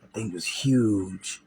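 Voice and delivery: slow, hushed whisper of disbelief; awed whisper. Retold or imitated. Recalling seeing the huge animal, slow awed whisper. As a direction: breathe it out like you can't believe your eyes. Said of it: "I'm like".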